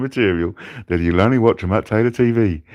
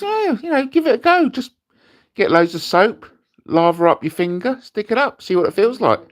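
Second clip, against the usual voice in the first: much higher, breezy mock-encouraging; mate giving advice; 14 semitones higher than usual. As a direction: coax him like it's nothing. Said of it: "he goes".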